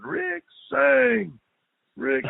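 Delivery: high, loud yelled name; retold yell. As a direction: call it like a mad coach across the stadium. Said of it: "I can hear him yelling".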